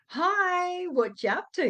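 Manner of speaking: high, loud put-on cheery greeting; fake happy. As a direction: open way up high, forcing the cheer. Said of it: "Fake smile, but the words sounded".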